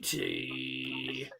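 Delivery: darts score caller. Retold or imitated. Mimicking caller at a throw.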